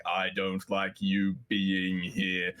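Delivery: slow, low dragon menace. Acted. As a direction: draw out each word as a displeased dragon. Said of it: "a dragon was like".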